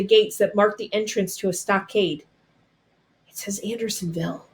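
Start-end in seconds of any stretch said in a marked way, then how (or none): 3.3-4.5 much softer, hushed and secret; a frightened hushed aside; hushed whisper. A narrator's reading. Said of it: "Pink whispered".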